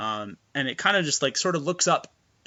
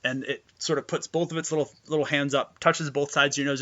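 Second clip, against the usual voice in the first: normal talking voice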